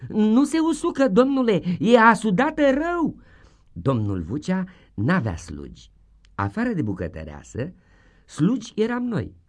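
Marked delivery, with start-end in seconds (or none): 0.1-3.2 high, loud schoolboy excuse; pleading child. Acted. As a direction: insist to the teacher. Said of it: "spuneam într-una".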